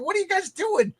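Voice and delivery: high, retold disbelief; pitched-way-up appalled question, played for laughs; incredulous. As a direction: squeak it out in mock bewilderment. Retells disbelief at the cooks.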